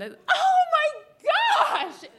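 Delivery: high, loud, drawn-out shriek; full-voiced retold scream. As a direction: scream it in scandalized shock. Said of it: "And I screamed".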